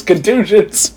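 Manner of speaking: contusions said high and drawn out; stress on contusions. Retold or imitated. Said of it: "That's the word they use".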